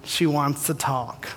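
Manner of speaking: hint of a calm divine voice, unhurried; God's gentle prompt. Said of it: "And God's like".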